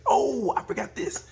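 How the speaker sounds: high, loud, slow mock surprise; mock surprised rider. Rins realising he forgot the part, hammed-up surprise. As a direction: squeal it out, hamming innocence.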